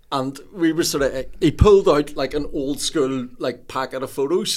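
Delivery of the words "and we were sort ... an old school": normal talking voice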